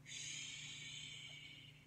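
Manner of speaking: soft breathy hush; whispered shh. A narrator's reading. The wind whispers to the bunny, soft shh. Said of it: "The wind whispered".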